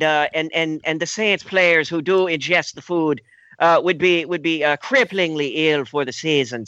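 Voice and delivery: put-on Irish accent. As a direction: ham up an Irish brogue. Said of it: "He doesn't have the Irish accent".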